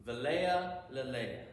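Velea lelea, dropping low on lelea; lovely Hebrew phrase. Shown as a demonstration. Teacher savoring the prettier Hebrew for the class. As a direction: say Velea lelea as something beautiful. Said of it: "that much prettier".

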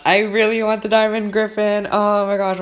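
high, loud nervous plea; anxious pleading. Real, not acted. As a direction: wish for it out loud, desperately. Pleading as the egg hatches.

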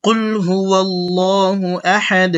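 slow, drawn-out tajweed recitation; slow Quran recitation. Shown as a demonstration. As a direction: recite slowly so they can repeat. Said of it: "আমার সাথে আপনারা একটু বলার চেষ্টা করুন".

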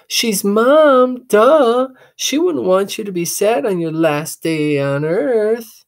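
put-on high, loud girl calling; bouncy teasing sister; little sister calling out; cheeky little sister. Sister answers.

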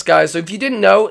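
usual speaking voice

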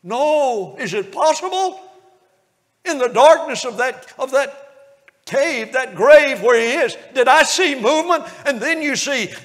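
fervent revival preacher wonder; preaching at full force. Acted. Preacher placing the congregation at the raising of Lazarus.

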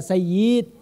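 "sayyid" with the last vowel drawn out; misplaced long vowel. Shown as a demonstration. Critic quotes speakers' vowel-length mistake, drawn-out ending on "sayyid". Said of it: "fi maddil huruf".

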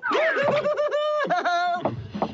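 gleeful mocking cackle; long high laugh. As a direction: cackle away. Mocking the sheriff.

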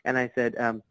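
ordinary talk

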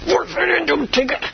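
high, strained voice, forcing it out; straining. Retold or imitated. Preacher mimicking his old forceful praying, straining. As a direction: push the words out under mock effort. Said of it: "I would strain".

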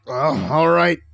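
strained groan into reluctant words; effortful, pained-sounding acceptance. A narrator's reading. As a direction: groan as you haul yourself up. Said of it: "Kale groaned, pulling himself back".

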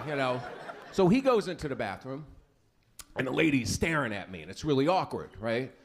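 ordinary talk

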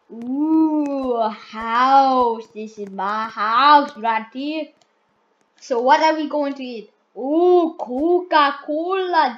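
loud and thrilled at each find; loud excited kid. Pretend. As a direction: gasp with delight at the house and the drink. Excited at finding things in game.

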